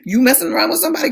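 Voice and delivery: fast, high accusing question; mock jealous accusation. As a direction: fire it off like a suspicious wife. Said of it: "I'm like".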